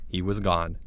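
usual speaking voice

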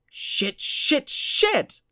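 from hushed to a high loud shriek; rising panic. A narrator's reading. Jennifer building panic.

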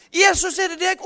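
high, loud cry across the water; calling out. Retold or imitated. Peter calling to Jesus from the boat, shouting. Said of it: "Og så roper han ut".

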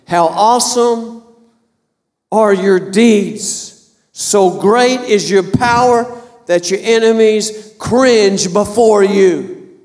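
each word drawn out, slow and solemn; slow scripture proclamation. Shown as a demonstration. Preacher reading Psalm to the congregation, slow and solemn. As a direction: proclaim the verse slowly, weighing every word. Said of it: "you gotta tell him".

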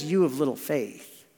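mild, affectionate chiding; endeared, not scolding. Acted. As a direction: say it fondly, with no scolding. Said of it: "because he's endeared to Peter".

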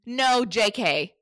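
normal talking voice

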